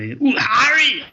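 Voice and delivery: high, loud northern Hagrid "Ooh, Harry"; a hammed-up, slow, high northern Hagrid; half-mastered northern giant voice; Hagrid impression. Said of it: "Hagrid's voice".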